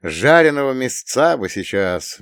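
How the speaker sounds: slightly lifted, wistful craving; dreamy. A narrator's reading. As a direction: wish for it dreamily. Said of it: "мечтательно протянул вдогонку".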